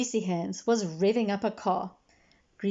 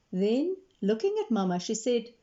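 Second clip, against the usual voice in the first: plain reading voice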